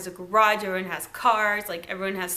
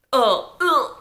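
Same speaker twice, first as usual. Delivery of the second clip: imitating his little lovesick ugh, louder; a playful double groan; mock swooning ugh; 3 semitones higher, 6 dB louder than usual. Recapping Joey swooning over Stephanie, mock groan. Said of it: "He does that little".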